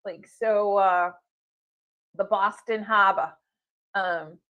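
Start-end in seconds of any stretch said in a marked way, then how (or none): 2.2-3.3 Harbor said hah-buh with no r, in a light Boston accent; a light Boston accent, r dropped in Harbor; a hint of a Boston accent, dropping the r. Shown as a demonstration. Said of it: "That's my Bostonian accent for the day".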